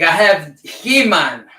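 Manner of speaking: usual speaking voice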